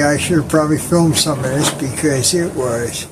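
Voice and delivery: ordinary delivery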